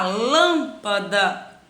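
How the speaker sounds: LÂMPADA slow, with a long LÂM; stretched stressed syllable. Shown as a demonstration. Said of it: "Encontrar minha sílaba tônica". (lâmpada devagar, com o "lâm" alongado)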